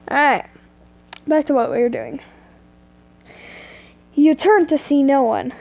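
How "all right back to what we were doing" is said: ordinary delivery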